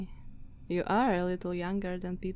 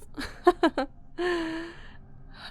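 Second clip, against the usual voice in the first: a high, scornful vampire laugh; mocking laugh; 10 semitones higher than usual. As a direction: laugh at the frightened guest. Mocking guest's fear of bats.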